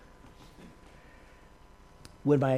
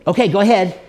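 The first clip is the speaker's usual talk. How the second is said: eager; 6 semitones higher, 11 dB louder, faster than usual. Retold or imitated. Woman ready, laugh heard.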